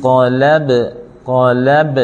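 กอลับ with a bounced final B; echoing B. Shown as a demonstration. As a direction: release the B of กอลับ with a small echo. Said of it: "อักษรสะท้อน ต้องออกเสียงสะท้อนนะครับ".

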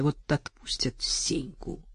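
hushed, spiteful whisper; gloating whisper. Retold or imitated. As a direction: whisper a sly threat. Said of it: "злорадно думала Настя".

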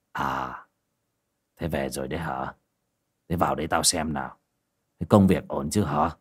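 a relieved father, gruff and low; gruff old father. Acted. Father greets returning son.

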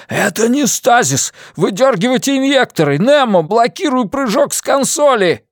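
high, loud, rapid-fire orders; urgent shouting. A narrator's reading. Crew member shouting orders in the crisis, urgent. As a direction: bark the orders in a panic.

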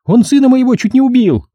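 high-pitched, outraged squeal; screeching woman. Acted. Mother demands policeman arrest the man, high screeching. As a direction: shriek a complaint to the police. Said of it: "визжала меж тем баба".

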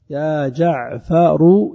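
"جعفر" drawn out in full as "jaʿfaru"; full vocative form. Shown as a demonstration. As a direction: slowly sound the final "ru" of "جعفر". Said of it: "تحذف التاء والضم". (نطق "جعفر" كاملاً بضم الراء مع المدّ)

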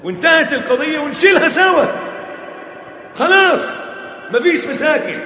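loud, high, impassioned preaching; preaching shout. Real, not acted. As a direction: hammer it home to the crowd. Preacher peaks at full force.

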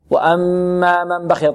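slow وأما من بخل with held hummed m sounds; doubled meem ghunna. Shown as a demonstration. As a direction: hold the nasal hum on وأما and من بخل. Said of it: "ميم مشددة فيها غنة". (وأما من بخل بغنة ظاهرة)

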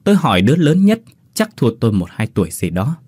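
ordinary delivery

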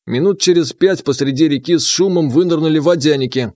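ordinary delivery

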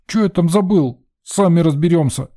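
put-on high-pitched, sulky little-boy defiance; huffy boy. A narrator's reading. Boy refuses to go upstairs, huffy and defiant. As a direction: snap back like a stubborn kid. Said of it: "Ещё сильнее засопел Ванька".

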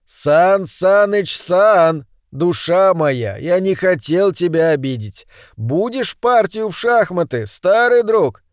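high, slow, plaintive wheedling; eccentric chess lover, coaxing. Acted. Orange man to his dead friend, coaxing. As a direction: plead fondly with a silent old friend.

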